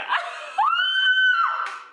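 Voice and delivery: a high, loud shriek of laughter. Real, not acted. Telling the perm story, she shrieks with laughter. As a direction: burst out in a screaming laugh.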